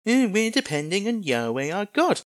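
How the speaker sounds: mock "we're depending" voice; mimicked objector. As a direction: parrot their claim in a put-on voice. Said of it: "If you say to me".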